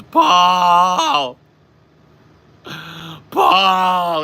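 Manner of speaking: put-on loud, high drawn-out drunken cry. Drunk friend calling out from the couch, loud imitated wail. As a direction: bellow the name in sloppy anguish. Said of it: "describe a drunk T J".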